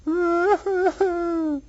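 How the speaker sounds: high-pitched pretend wail; mock crying. Teacher acts out the weeping bus, high mock crying.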